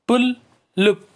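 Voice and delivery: "पुलुप" slow and high, split as पुल, लुप; syllable by syllable. Shown as a demonstration. Teacher showing children two syllables, syllable by syllable. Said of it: "इसमें भी दो syllable".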